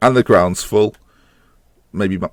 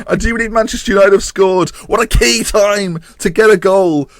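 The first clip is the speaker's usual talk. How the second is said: high-pitched voice; 13 semitones higher, 5 dB louder than usual. Real, not acted. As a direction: keep the squeak as he savours the goal. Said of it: "the high-pitched voice is back".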